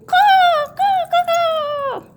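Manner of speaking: piercing high bird caw. Pretend. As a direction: screech like a hawk. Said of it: "That's probably not what the eagle makes".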